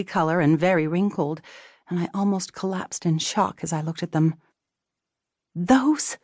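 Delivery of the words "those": a high, breathless "Those?"; gasped. A narrator's reading. Wife seeing the ugly pants, gasping in shock. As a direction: gasp it out in horror. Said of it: "I gasped".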